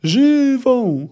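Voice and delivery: long, high wailing shout; high drawn-out yell; howled cry. A narrator's reading. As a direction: stretch the word into a high howl. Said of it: "zawył Ryans".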